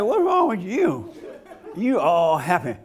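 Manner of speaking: slow, drawn-out mock accusation; hammed-up wifely needling; nagging wife. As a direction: play a suspicious wife, half laughing. Acted nagging wife confronts happy husband.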